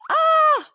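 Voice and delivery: loud, high, drawn-out "ah"; high burst of grateful excitement; delighted squeal. Real, not acted. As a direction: squeal with delight at your guest. Gushing thanks to departing guest.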